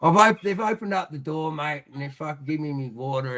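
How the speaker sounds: ordinary talk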